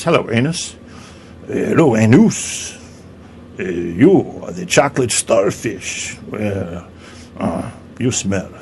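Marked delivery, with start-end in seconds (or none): 1.5-2.8 "anus" said "ah-NOOS", French style; mock French accent. French vagina greets the anus, "anus" in mock French accent. 3.6-8.5 put-on slow, disdainful comic French accent; mock French accent. French vagina insults the anus, slow mock French accent.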